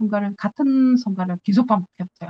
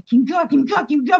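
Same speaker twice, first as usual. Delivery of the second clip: retold exasperation; 6 semitones higher, 3 dB louder than usual. Retold repeated writing of a name.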